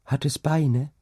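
high, small questioning voice; child's voice. A narrator's reading. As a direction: ask like an anxious little girl. Child asks mother about the sea. (hohe, fragende Kinderstimme)

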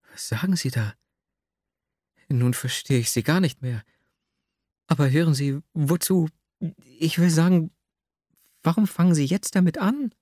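flustered heroine, pitch climbing through broken questions; sudden confusion. A narrator's reading. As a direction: stumble from puzzlement into high-pitched bewilderment. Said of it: "Nastjenka wurde auf einmal ganz verwirrt". (plötzlich ganz verwirrt)

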